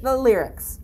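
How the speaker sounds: ordinary talk, nothing marked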